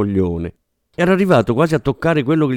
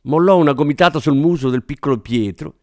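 ordinary talk, nothing marked

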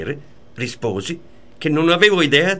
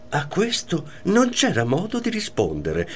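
ordinary delivery

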